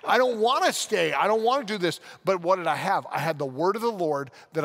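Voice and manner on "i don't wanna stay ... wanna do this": higher, louder frustrated complaint; mock-angry grumble about staying; retold angry outburst. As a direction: vent like a stuck, annoyed young man. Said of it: "I was pissed".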